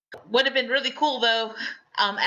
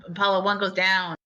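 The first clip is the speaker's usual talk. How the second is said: normal talking voice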